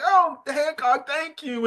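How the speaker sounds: light, high-pitched mock gratitude; Luffy's grateful voice. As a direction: imitate a delighted hero saying thanks. Acting Luffy thanking Hancock.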